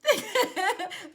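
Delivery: loud, high burst of laughter; sudden high cackle. Real, not acted. Mocking the partner's friends.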